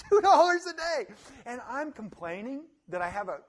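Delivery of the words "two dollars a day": loud, high laughing disbelief; louder incredulous laugh. Real, not acted. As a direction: laugh at the absurdity.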